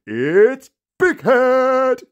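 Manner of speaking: hammed-up arena reveal; ring announcer intro. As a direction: belt out the name like a fight announcer. Wrestling-style entrance announcement.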